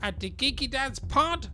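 high, soft squeaky villain read; high cartoon villain voice. Reads outro in high put-on voice.

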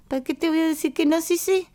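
high, quick comic character voice; el Chavo. Retold or imitated. Quoting a comedy character's catchphrase, high-pitched imitation. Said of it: "Me parece que era el Chavo".

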